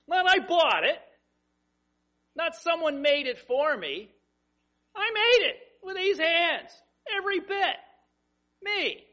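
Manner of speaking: loud, high boasting take; bragging. Shown as a demonstration. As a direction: show off every word, chest puffed out. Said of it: "the way I said that sort of sounded like the bragging".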